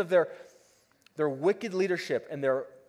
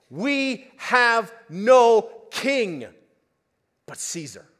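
shouted reply; 6 semitones higher, 10 dB louder, much slower than usual. Acted. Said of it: "And they shout back".

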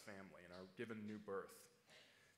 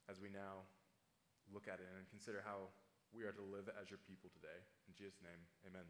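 soft, hushed closing prayer; 4 semitones lower, 4 dB quieter than usual. Acted. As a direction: pray it quietly, bowed before God. Pastor prays after the reading.

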